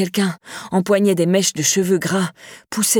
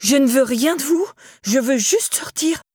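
fear in her voice; 6 semitones higher than usual. A narrator's reading. Laura trapped in the cabin, pleading in fear. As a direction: let the panic show as you beg to leave. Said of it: "la peur dans sa propre voix".

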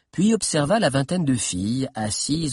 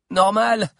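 raised, punchy interjection; short, higher-pitched remark; girl's approving comment; 8 semitones higher, 5 dB louder than usual. A narrator's reading. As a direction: chime in firmly from the crowd. Figure of a girl commenting.

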